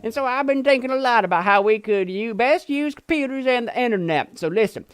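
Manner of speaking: high put-on accent for a cheery nineties web guy; put-on accent as Justin. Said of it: "I don't know why I've given him this a-, accent".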